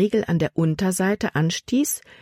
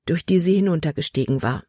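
plain reading voice

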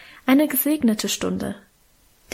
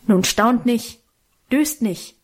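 mildly growled worker's order; gruff growl; slower than usual. A narrator's reading. Worker to shocked children at the sickbed, gruff. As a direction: grumble it like a no-nonsense workman. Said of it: "knurrte der handfeste Arbeiter".

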